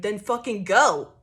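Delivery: loud, slow "Then fucking go"; slow, loud mock brush-off; mock angry dismissal. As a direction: spit it out like an ultimatum. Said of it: "It's like".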